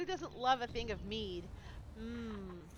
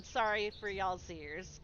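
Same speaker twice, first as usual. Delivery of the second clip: ordinary delivery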